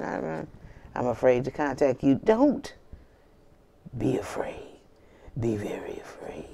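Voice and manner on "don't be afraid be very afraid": breathy horror-movie whisper; spooky whisper. Acted. Reader teasing viewer about spell work, mock-spooky whisper. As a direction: hush it like a scary trailer. Spooky warning after laugh.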